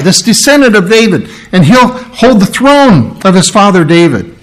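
a lightly voiced excited angel spilling news; excited Gabriel. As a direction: gush it out like an overjoyed angel. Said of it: "he's bouncing up and down".

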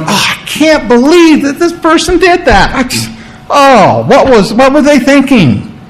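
high, exasperated mock shock; hammed-up what-were-they-thinking; mock disbelief. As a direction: pretend to be stunned by someone's choice. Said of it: "he's not shocked".

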